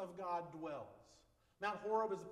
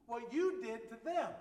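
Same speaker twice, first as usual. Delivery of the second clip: louder, higher, slow deliberate threat; queen's threat; 6 semitones higher, 7 dB louder, slower than usual. Acted. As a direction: deliver the warning slowly and forcefully, as a queen. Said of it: "this is the queen talking".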